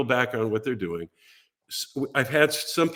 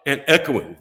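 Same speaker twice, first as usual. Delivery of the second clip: usual speaking voice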